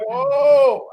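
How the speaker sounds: high, startled whoa; comic shock. Acted. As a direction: recoil in exaggerated surprise. Acted shock at her voice.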